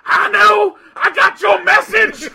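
high, full-volume angry yell; loud, high screeched brush-off; angry shouting. Retold or imitated. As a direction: shout them away from the door. Client's angry reply retold.

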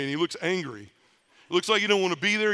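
normal talking voice

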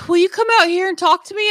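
high pleading request; imitating Jacob. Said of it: "And he's like".

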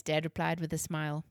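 usual speaking voice

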